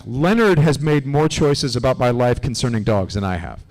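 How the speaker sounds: ordinary delivery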